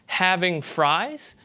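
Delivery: "having fries", slow, with -ing and full I; mainstream US accent. Shown as a demonstration. Lecturer contrasts accents, mainstream US "having fries". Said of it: "you hear that as a mainstream U S accent".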